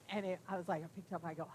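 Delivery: ordinary delivery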